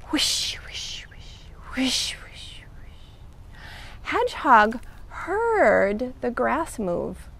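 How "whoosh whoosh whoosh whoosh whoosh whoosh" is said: soft, fading rustle in a whisper; whispered whooshing. Acted. Narrator imitating Snake in the grass, whispered whooshing.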